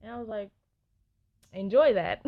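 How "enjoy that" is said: loud sarcastic enjoy that. Retold or imitated. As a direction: toss it off sarcastically. Sarcastic reply to friend.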